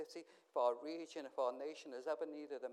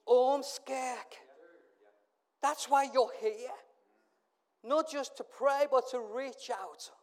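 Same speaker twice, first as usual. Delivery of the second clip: loud, slow rallying call from the pulpit; preaching at full force; 7 semitones higher, 9 dB louder, slower than usual. Real, not acted. Preacher urging the Ormskirk church to reach out, loud and slow. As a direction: drive each word home, louder and slower. Preacher charges his congregation.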